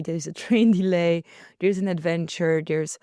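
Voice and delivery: ordinary talk, nothing marked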